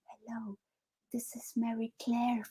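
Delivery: imitating a frozen, whispered camera greeting; mock nervous whispered intro to the camera; tight, shy whisper. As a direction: barely voice the hello, stiff and nervous. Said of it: "I'm standing there all tight".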